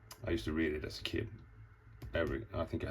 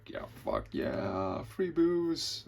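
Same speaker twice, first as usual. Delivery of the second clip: put-on drunk party cheer; 9 semitones higher, much slower than usual. Reveler cheering about free booze, excitedly. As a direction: stretch it out, then squeal.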